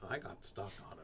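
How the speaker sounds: low, soft deadpan loop; deranged repetition. Pretend. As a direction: mutter it low like a stuck record. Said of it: "You look deranged".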